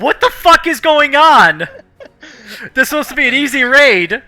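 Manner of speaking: high, loud, panicked outburst; panicked captain. Pretend. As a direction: freak out at the chaos. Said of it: "he's a little like".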